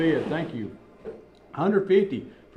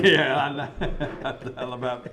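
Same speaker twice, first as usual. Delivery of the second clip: laughing. Real, not acted. Laughs at range balls remark.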